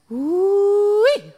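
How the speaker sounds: high, loud admiring whoop; excited whoop. Acted. Wolf whooping at the pretty women on the veranda. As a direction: let out a big delighted ooh-wee.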